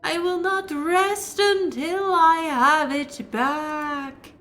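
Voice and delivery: high, slow, wailing ghost woman; wailing wraith. Acted. Wraith shrieking at the intruder, high wailing moan.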